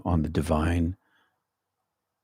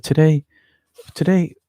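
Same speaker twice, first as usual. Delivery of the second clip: playful emphasis; 10 semitones higher, 6 dB louder than usual. Real, not acted.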